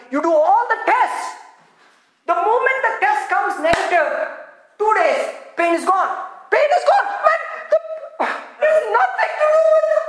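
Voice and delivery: high, rising, emphatic preacher delivery; excited preaching. Acted. Preacher telling congregation the pain is gone, excited.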